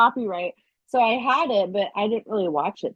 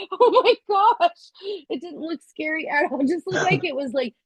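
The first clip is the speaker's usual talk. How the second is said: laughing husband; 7 semitones higher than usual. Retold or imitated. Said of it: "So my husband starts laughing".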